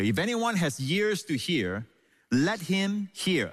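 high, drawn-out "let him hear"; emphatic. Shown as a demonstration. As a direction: stretch "let him hear" slowly with weight. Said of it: "Again, the emphasis".

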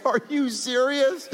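high, slow, laughing question; laughing disbelief. Retold or imitated. Laughing as he retells asking the officer if he's serious. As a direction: ask it through laughter, still stunned.

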